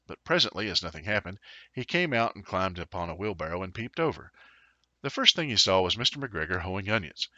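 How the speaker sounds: ordinary delivery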